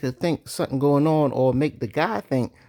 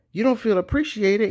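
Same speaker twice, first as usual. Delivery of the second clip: ordinary delivery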